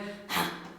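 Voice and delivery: soft, thin pitchless grunt; quiet breathy grunt, no body; grunt without undertones. Shown as a demonstration. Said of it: "not giving all these undertones".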